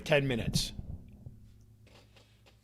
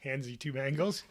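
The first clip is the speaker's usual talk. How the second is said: ordinary delivery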